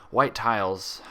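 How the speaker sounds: plain reading voice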